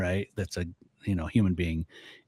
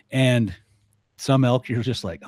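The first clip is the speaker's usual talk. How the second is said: ordinary talk, nothing marked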